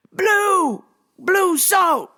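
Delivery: mock customer yell, high and drawn out; imitated yelling. Customer to worker four feet away, yelling. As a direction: bellow each word like he can't hear himself. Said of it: "but then would yell".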